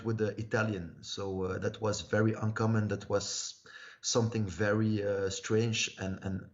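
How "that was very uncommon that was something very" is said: ordinary talk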